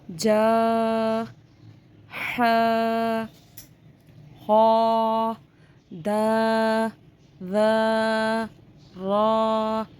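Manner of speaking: each syllable from बा to या held long on a level tone; stretched letter drill. Shown as a demonstration. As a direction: recite बा, ता, सा one by one, stretching every final आ. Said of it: "आ खींचेंगे". (हर हर्फ का आ एक अलिफ़ बराबर खींचकर)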